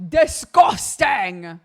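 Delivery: slow, loud "Disgusting". Real, not acted. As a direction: drag it out loudly in disgust. Exclaiming about food.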